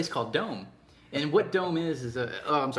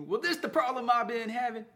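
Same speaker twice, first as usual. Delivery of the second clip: raised-pitch mock Texan accent; 7 semitones higher than usual. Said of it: "he's real hicking it up".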